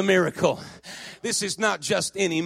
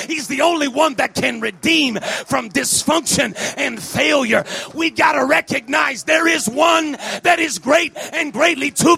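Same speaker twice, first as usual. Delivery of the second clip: loud, rousing preacher in full cry; volle prediking; 4 semitones higher, 5 dB louder than usual. Acted. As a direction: build it phrase by phrase to a climax.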